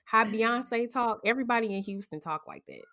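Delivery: usual speaking voice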